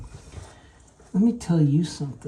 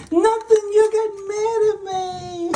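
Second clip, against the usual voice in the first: high whiny voice, much higher; helper's voice. Retold or imitated. Boss imitating the helper's complaint in a high whiny voice. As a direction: protest like a hurt child.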